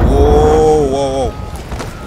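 startled. Real, not acted. Startled by napalm.

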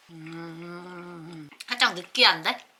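talking around a hot bite; mouth full of hot food. Real, not acted. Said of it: "아, 뜨거".

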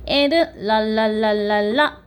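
"랄랄랄랄라" as rapid tongue-tip L's; L sound demo. Shown as a demonstration. Teacher contrasts L with R, repeated L sounds. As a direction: tap out "랄랄랄랄라" like a la-la exercise. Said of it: "혀가 입천장에 닿으면".

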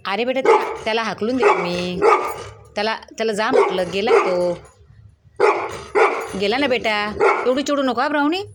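calm the angry dog down, sing-song; soothing the dog. Real, not acted. Soothing the barking dog Brownie. (कुत्रीला उंच आवाजात समजावणे)

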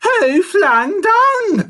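high, loud, drawn-out monkey cry; outraged shout. A narrator's reading. Monkey hit while minding his business, outraged shout. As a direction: call it out slowly at full pitch. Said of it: "Furley said".

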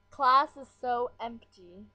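slow, loud drawn-out reading of a text; mock-dramatic text reading; reading a text aloud. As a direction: read the message out, stretched and loud. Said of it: "I'm getting a text".

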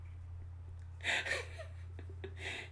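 breathless giggle; quick breathy laugh; unvoiced laugh. Real, not acted. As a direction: laugh at the slip. Reader laughs at own mistake.

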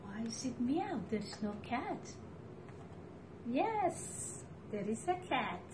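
ordinary delivery

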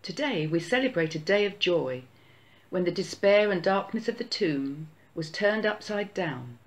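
ordinary talk

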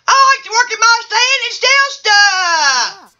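high-pitched put-on voice, held nearly level; odd bachelor character. Bachelor one answering the host's question, high put-on voice. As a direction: chant it high, stretching the final word.

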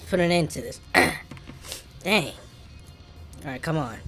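normal talking voice

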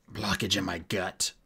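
low, strained dwarf voice; pained grunt. Acted. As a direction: grunt it out through a bellyache. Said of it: "grunted the dwarf".

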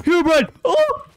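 sudden terrified yelp, then disbelief; startled scream. Real, not acted. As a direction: shriek in real fright. Jump scare from Herobrine.

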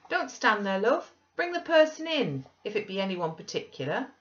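loud, high calling out; exclaimed call. A narrator's reading. Husband calling from the window to wife below, exclaiming. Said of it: "he exclaimed quickly".

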